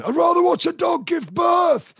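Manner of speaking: big, high, slow shouted heckle; slow, exaggerated terrace yell from a disgruntled bloke; mock bloke's shout. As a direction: bellow it like a fed-up fan in the stands. Football bloke heckling at City Ground.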